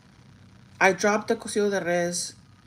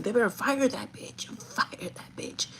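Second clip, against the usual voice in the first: whispered, hissed-out demand to fire her; a boyfriend whispering angrily that she should be fired; mad boyfriend; 4 semitones higher, 4 dB quieter, slower than usual. Retold or imitated. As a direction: growl it under your breath like a furious boyfriend.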